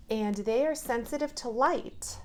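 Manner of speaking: usual speaking voice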